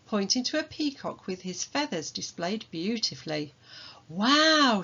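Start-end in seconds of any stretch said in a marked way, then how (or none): 4.1-4.8 higher, louder awed wow; amazed wow. Acted. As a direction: gasp at the peacock. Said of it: "said Hattie".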